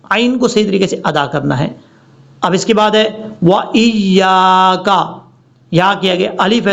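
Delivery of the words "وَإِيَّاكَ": 'وَإِيَّاكَ' with stretched yaa; long alif. Shown as a demonstration. Teacher introduces the word, drawn-out recitation. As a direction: lengthen the middle of 'وَإِيَّاكَ'. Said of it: "لمبا کر کے پڑھنا ہے".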